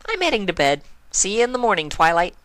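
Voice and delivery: perky and upbeat; Pinkie Pie voice. Acted.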